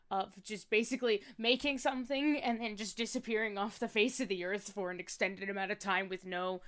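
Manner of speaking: normal talking voice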